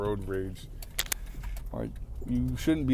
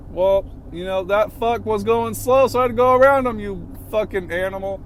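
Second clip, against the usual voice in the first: much higher and louder, furious at the honker; road rage rant; 9 semitones higher, 6 dB louder than usual. Real, not acted. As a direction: let it rip at the other driver. Ranting at a honking driver.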